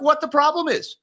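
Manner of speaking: high, faux-innocent shrug of a question; mock bafflement. Mock quote of his own reaction.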